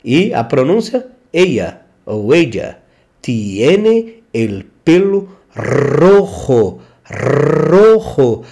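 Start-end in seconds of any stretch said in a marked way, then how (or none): 3.2-8.4 rojo with a trilled rr at the start; teacher showing the trilled R in rojo; rolled R. Shown as a demonstration. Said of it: "tem que tremer aqui a língua".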